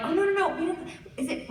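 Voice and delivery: high-pitched, flustered backtracking; hasty correction. Retold or imitated. Clients correcting the actor's misunderstanding, awkwardly high. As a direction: act out an awkward hurried denial.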